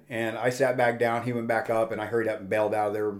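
usual speaking voice

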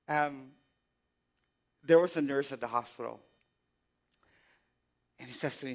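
normal talking voice

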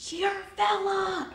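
calling out. Retold or imitated. Driver recognizing her from his car, slow high call-out. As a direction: shout it out of a car window, stretched. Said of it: "He was like".

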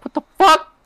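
high, loud disbelieving shriek; retold shock at a heroin offer, shrieked high; shocked outburst. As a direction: react like you can't believe it.

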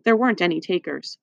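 ordinary delivery, nothing marked

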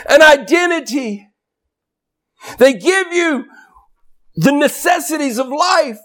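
high, sustained pulpit cry; full-force preaching. Real, not acted. Preacher telling congregation God claims them as sons, full-force preaching. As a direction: preach it out at a high pitch, driving each phrase home.

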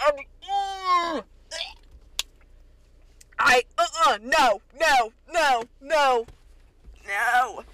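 loud, high disgusted refusal. Real, not acted. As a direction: back away in horror. Recoiling at the line.

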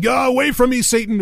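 high-pitched mock banishing cry; mock exorcist. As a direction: ward off the devil in playful mock alarm. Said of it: "holding up a cross here saying".

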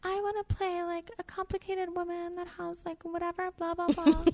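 mock excited teen voice; eager young actress. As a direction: gush out your dream role. Her eager younger self.

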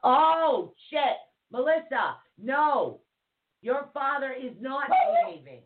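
high, sudden exclaim; jolted into a high yelp of surprise; startled outburst. Real, not acted. Streamer yelps at chat news about ghost dad, surprised.

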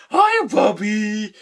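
loopy, drawn-out high squeal; loud, slow, delighted imitation of someone drugged; Amos, high and out of it. Drugged brother greets sister on the phone, loopy. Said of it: "Amos is high and out of it".

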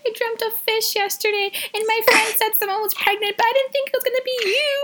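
high, teary excited voice; emotional sister. Retold or imitated. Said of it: "and she was like".

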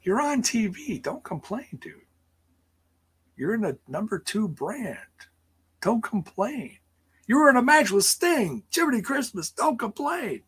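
high-pitched comic rant; mock exasperated scolding. Host chiding a wrestler for griping, mock exasperated. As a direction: scold in a high, put-upon squeal.

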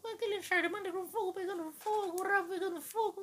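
put-on very high squeaky teenage rant; teen voice cracking. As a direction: rant like a teen whose voice is breaking. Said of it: "Aquela voz de adolescente".

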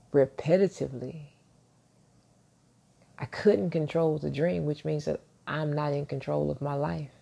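ordinary talk

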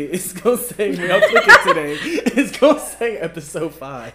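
laughing loudly; giggling through the words. Real, not acted. Laughing at the episode mix-up.

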